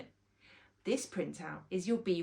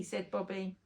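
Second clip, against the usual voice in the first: usual speaking voice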